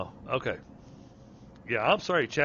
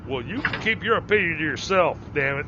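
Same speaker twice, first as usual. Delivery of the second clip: high, loud comeback; raised-voice mock grumbling; mock indignant retort; 7 semitones higher, 8 dB louder than usual. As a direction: push back in hammed-up annoyance. Acted retort to coworkers' praise.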